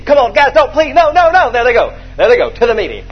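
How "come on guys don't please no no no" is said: mock pleading. [name] pleading while carried.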